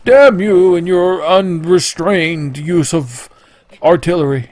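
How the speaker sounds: slow, high theatrical damning; slow, high mock-dramatic damn you; dramatic curse. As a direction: curse Link like a melodrama villain, slowly. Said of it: "He's like".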